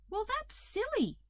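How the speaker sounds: high, light caterpillar voice; reassuring Hazel. A narrator's reading. Hazel cheering up the toad, reassuring. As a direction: lift the pitch to brush it off kindly.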